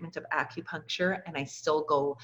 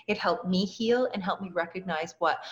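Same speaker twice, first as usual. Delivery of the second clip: ordinary talk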